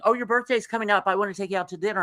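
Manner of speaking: high, rushed mock phone invitations; eager friends imitated. Friends calling about a birthday, imitated in an eager rush. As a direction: imitate insistent callers, quick and high-pitched.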